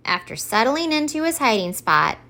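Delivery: plain reading voice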